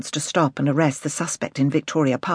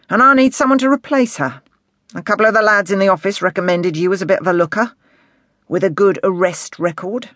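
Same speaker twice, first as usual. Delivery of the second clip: earnest inspector, pitch partly raised; serious; 5 semitones higher, 4 dB louder than usual. A narrator's reading. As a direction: explain the job in all seriousness. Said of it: "he said, in a serious voice".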